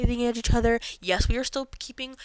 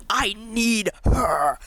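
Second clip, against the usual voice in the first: mock desperate; much slower than usual. Retelling her desperate crush.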